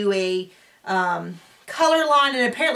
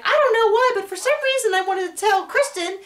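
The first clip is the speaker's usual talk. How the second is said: imitating Carol; 11 semitones higher than usual. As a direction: play a gossipy cast member in a squeaky put-on voice. Carol quoted in the recap.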